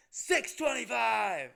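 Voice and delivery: loud, high, drawn-out yell. Retold or imitated. Bartender repeats price to customer who misheard, yelling. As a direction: imitate an exasperated bartender shouting the price. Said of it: "Then she starts yelling".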